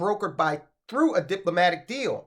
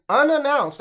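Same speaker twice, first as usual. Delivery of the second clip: "unannounced" leaned on, louder and higher; stress on unannounced; 7 semitones higher, 5 dB louder than usual. Real, not acted. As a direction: punch the word "unannounced". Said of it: "I want to stress that word".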